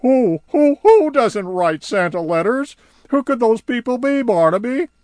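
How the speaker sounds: high, loud frantic questioning; who could it be, urgently; High quizzing Barnaby, high and loud; puzzled High. Acted.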